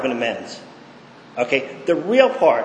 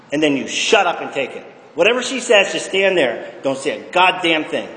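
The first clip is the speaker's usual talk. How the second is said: ordinary delivery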